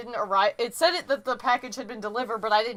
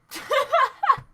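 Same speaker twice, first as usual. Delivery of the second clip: high burst of laughter; 14 semitones higher, 4 dB louder than usual. Real, not acted. Cracking up after a lost sneeze.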